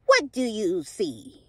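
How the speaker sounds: slow eerie witch voice. Acted. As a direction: drag it out like a witch. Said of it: "I said the witch's voice".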